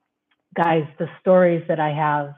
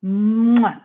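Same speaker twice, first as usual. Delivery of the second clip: louder, drawn-out "mwah" kiss; big blown kiss; 4 semitones higher, 5 dB louder than usual. Real, not acted. Host asking viewers for kisses, big blown kiss. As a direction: plant an emphatic goodbye kiss. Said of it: "Give me kisses".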